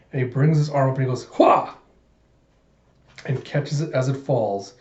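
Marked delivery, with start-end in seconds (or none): 1.3-1.7 loud effortful strike cry; martial arts yell. Acted. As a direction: shout as you throw the punch. Fighter throws a punch.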